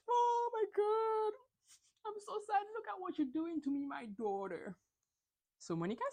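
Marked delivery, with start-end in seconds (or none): 0.1-4.7 much higher, hammed-up sobbing wail; milk the tears for a guilt trip; fake crying. Mother to daughter at the diner, hammed-up fake sobbing. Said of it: "her mom finishes fake crying".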